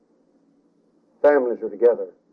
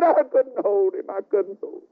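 wailing mother; 6 semitones higher than usual. Acted. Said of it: "mothers screaming and said".

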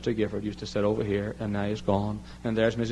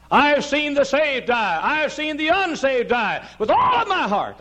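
slow, high, loud preaching cry; full-force preaching; 5 dB louder, slower than usual. Acted. Preacher testifying to the congregation, slow and loud. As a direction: drive each word home from the pulpit.

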